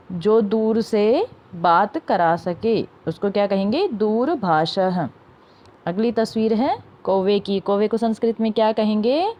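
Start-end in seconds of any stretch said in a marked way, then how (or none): 3.9-5.1 दूरभाषः slowly, with a sounded "ha" at the end; model pronunciation. Shown as a demonstration. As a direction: stretch दूरभाषः, falling onto "ha". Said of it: "उनको भी उच्चारण करना है".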